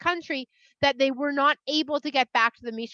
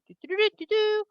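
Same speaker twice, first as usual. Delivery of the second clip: trumpet call; 8 semitones higher, faster than usual. Retold or imitated. Moshe's new trumpets sounding, sung as a toot. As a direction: sing a high little trumpet call.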